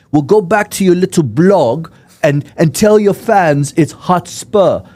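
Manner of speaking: high, loud mock English rant; scornful English fan, stretching the last word; offended English accent. Drunk fan scolding a visiting blogger, indignant English accent. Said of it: "in his English accent".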